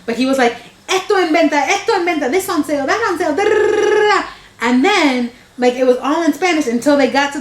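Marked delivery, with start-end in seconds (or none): 0.9-4.2 ad announcer. Retold or imitated. Imitating the dubbed commercial announcer.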